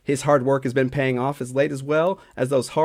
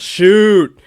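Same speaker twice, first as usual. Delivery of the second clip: loud, higher-pitched mock fan yell; yelled shout; 6 semitones higher, 12 dB louder than usual. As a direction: yell it out like an overeager hockey fan. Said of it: "that guy who constantly yells".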